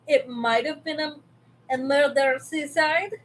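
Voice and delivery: put-on, vaguely Russian accent; weird accent. Streamer dodging demonetization on a grim topic, in a weird accent. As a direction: say it in an odd, made-up foreign accent. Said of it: "I say it with a, a weird accent".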